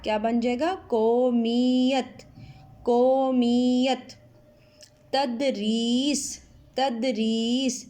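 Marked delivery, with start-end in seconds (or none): 0.9-4.1 قومیت drawn out slowly, a bit louder; slow louder model. Shown as a demonstration. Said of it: "یہ کے اوپر تشدید". 5.1-7.9 تدریس said slowly, each sound clear; slow model word. Shown as a demonstration. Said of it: "اس میں تے زبر تا".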